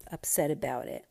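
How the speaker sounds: ordinary talk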